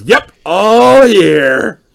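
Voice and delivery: high, slow, loud teasing drawl; sultry drawl. Acted. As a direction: drawl it out suggestively. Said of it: "jiggles her boobs a bit and goes".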